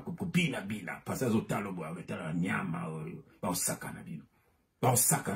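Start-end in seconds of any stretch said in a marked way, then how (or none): 1.4-4.2 sudden whisper, much softer; hushed whisper. Acted. Preacher warning the faithful in a whisper. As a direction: drop to a secretive whisper.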